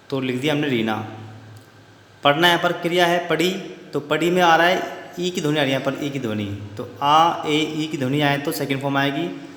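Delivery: ordinary talk, nothing marked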